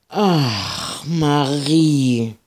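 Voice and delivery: high, drawn-out exasperated sigh of a name; groaning. Acted. Ida groaning at Marie's lasso plan. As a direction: moan her name in weary despair. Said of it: "stöhnte Ida".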